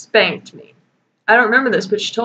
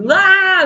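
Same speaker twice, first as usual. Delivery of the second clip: mock scream; 10 semitones higher, 4 dB louder than usual. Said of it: "that's just what I imagine".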